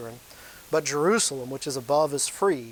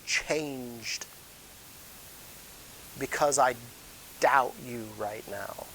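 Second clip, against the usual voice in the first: lower, halting delivery as Paul; changed, doubting voice; 4 semitones lower, 5 dB quieter, much slower than usual. Acted. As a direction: drop the voice, heavy with doubt. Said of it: "you would hear my voice changed".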